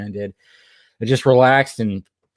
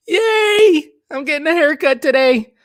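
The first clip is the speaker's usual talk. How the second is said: much higher, louder squeal of glee; hammed-up excitement; 5 dB louder, slower than usual. Streamer telling chat about his haircut, mock squealing with glee. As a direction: play up the joy like a kid. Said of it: "I am so excited".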